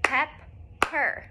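pep-per split into two clapped beats; syllable by syllable, pep-per. Shown as a demonstration. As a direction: clap out each syllable of pepper, soft. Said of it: "let's clap out pepper".

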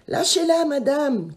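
high, loud pleading cry; boy's shout. A narrator's reading. Vincent shouting at the witch to free his sister, high and loud. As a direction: yell to make her let go. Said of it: "crie Vincent".

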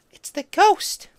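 high, loud frightened outburst; little Geary blurting it out to the room in fright; blurted cry. A narrator's reading. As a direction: burst out before she can stop herself. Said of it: "little Geary blurted".

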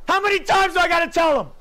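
furious yell at the screen; angry shout. Real, not acted. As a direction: shout it out in frustration. Gamer ranting at opponent.

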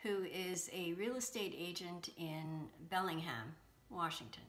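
soft, even announcer read; hushed podcast intro narration; intro voiceover. Acted. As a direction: read the show intro quietly and smoothly. Host reads recorded intro.

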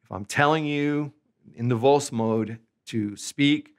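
usual speaking voice

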